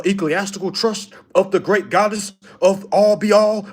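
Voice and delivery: loud, pompous mock title; mock grandiose. Follower proposing a fancy trust name, mocked as grandiose.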